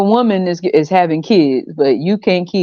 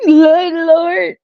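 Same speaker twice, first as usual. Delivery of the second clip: high wheezing laugh through words; helpless laughter; crying laughing; 12 semitones higher than usual. Real, not acted. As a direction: laugh till it squeaks. Laughing fit.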